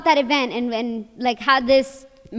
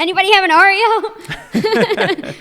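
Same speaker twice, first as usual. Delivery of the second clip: laughing; 5 semitones higher, 5 dB louder, much slower than usual. Pretend. Imagined asking on the show.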